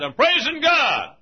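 high, loud and slow proclamation; joyful reply. Retold or imitated. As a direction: announce it proudly, drawn out. Preacher's joyful reply retold.

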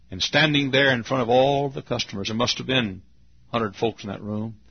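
usual speaking voice

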